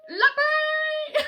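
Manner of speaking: very high loud squeal then laugh; excited squeal. Acted. Storyteller springs the surprise, squealing. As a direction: shriek with delight. Transformation climax.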